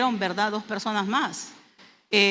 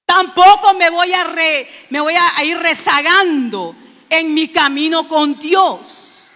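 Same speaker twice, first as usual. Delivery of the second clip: fast, loud, raised preaching voice; fervent preaching; 5 semitones higher, 10 dB louder, slower than usual. Acted. Preacher exhorting the brethren about Enoch, loud and fervent. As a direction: preach it out to the congregation.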